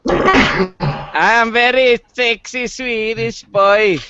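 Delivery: put-on Swedish accent. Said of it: "it's Little Sweden".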